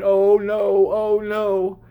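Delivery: high, anxious "oh no" as a fretting God; mock panic. Preacher mocking the idea of a panicked God, high and fretful. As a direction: mimic a worried God wringing his hands. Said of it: "God's not up there going".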